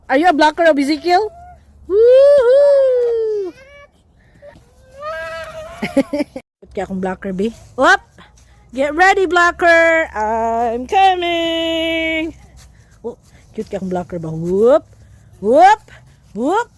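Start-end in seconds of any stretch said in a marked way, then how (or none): 1.9-3.5 high, long drawn-out whoop; elongated high celebration; cheering. Real, not acted. Cheering in kick game. 10.2-12.3 very slow, drawn-out announcement; long, teasing wind-up; playful warning. Real, not acted. Playful warning before kicking to blocker.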